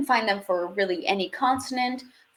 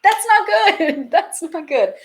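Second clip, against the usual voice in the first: laughing dismay; 8 semitones higher, 8 dB louder than usual. Retold or imitated. Acts out her amused dismay.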